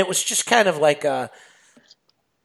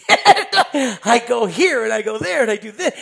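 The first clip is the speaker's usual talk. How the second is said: high, loud, eager rundown of the day; retold excitement with laughter creeping in; excited; 7 semitones higher, 8 dB louder than usual. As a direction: gush it out, stretching the last word. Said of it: "And I'm excited".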